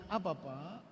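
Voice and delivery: a soft respectful "Apa, Pak?"; soft polite question. Shown as a demonstration. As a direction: ask it almost under the breath, respectfully. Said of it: "Lihat bedanya".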